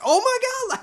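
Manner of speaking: mock startled "oh my God" reaction; imitated freak-out. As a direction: mimic someone freaking out in shock. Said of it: "like, freaking out".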